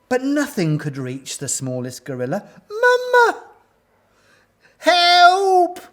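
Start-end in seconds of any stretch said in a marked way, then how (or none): 2.7-3.4 very high, loud cry for mum; shrill little child's distress call. A narrator's reading. Drowning baby gorilla calls mother. 4.8-5.8 loud, high wail for help; long, drawn-out distress cry. A narrator's reading. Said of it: "wailed Abu's mother".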